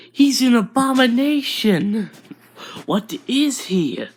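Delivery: high-pitched, scandalised crowd voice; acted horror, pitch jumping up; shocked outcry. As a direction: cry out in appalled disbelief. Shocked crowd reacts to the child.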